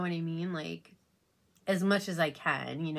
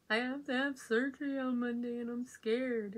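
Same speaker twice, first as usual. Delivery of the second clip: higher, a touch of retold fear; scared reply; 7 semitones higher than usual. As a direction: retell your own frightened answer, understated.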